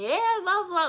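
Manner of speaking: high, mocking grandma voice; egging it on in a squeaky old voice; grandma mockingly stirring up the fight, high-pitched; mock instigating grandma. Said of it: "grandmother was instigating".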